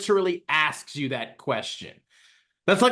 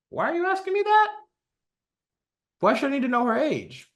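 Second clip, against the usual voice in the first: much higher, suspicious player asking the GM; high incredulous question; 8 semitones higher, faster than usual. Pretend. Showing OP what to ask the GM, in a high suspicious voice. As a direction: ask it pitched way up, openly suspicious.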